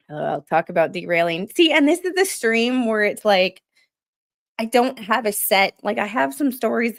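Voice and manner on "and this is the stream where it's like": ordinary talk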